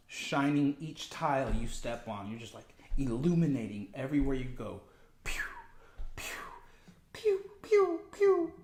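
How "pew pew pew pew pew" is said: high "pew" zap sounds; playful beam sound effect; imitated laser zaps. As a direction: fire off little high-pitched light beams. Imitating beams of light shooting out.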